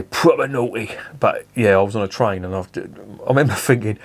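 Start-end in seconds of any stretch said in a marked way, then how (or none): 0.0-0.9 lightly voiced Cockney geezer, only a hint of Cockney; a touch of Cockney hard man; Danny Dyer. Quoting the actor's catchphrase to the viewers, a hint of Cockney. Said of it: "as Danny Dyer would say".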